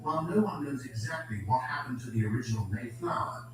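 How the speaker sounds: usual speaking voice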